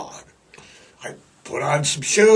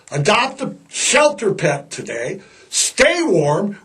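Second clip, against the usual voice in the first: ordinary talk, nothing marked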